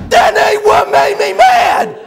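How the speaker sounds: slow, high, furious mock bellow; hammed-up tongue-tied roar; Buddy. Buddy corrects the preacher, bellowing in worked-up rage. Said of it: "when he gets real worked up".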